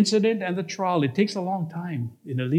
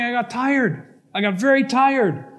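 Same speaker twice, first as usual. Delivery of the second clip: emphatic complaint; 6 semitones higher than usual. Acted. Recounts growing weariness, acted emphatically.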